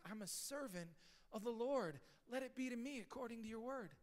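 whisper-close, yielding reply; humble surrender. Retold or imitated. Pastor voicing Mary accepting the angel's word, soft and humble. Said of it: "What a posture".

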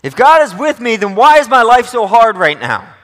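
a cheeky sarcastic challenge to God; sarcastic doubter. Retold or imitated. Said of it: "Cheeky and sarcastic".

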